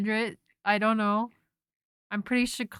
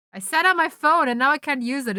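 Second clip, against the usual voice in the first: louder, higher exasperated complaint; 5 semitones higher, 6 dB louder than usual. Real, not acted. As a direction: vent about the stuck phone. Phone locked, frustrated.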